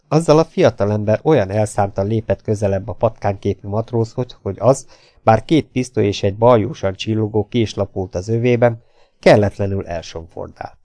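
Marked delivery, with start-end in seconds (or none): none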